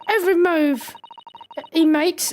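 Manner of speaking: high put-on girl's voice, exasperated. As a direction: do an awkward high-pitched girl. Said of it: "trying to do a girl's voice".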